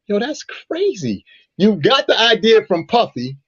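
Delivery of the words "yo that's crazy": high, drawn-out disbelief; amazed reaction. Retold or imitated. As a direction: recall being blown away, stretching it out high. Retold reaction, blown away.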